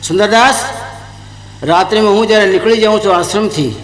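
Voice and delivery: ordinary talk, nothing marked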